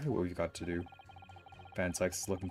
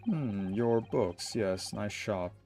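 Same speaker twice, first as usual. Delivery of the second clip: put-on very old man; much slower than usual. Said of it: "I was trying to do the old man voice".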